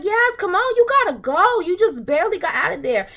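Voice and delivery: high, loud pleading shout at the screen; urging yell. Retold or imitated. Yelling at Yaz on screen to leave, high and loud. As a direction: yell at Yaz to get out, now.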